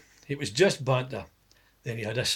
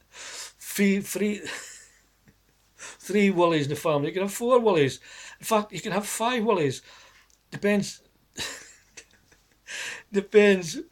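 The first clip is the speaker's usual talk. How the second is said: laughing; 7 semitones higher than usual. Real, not acted.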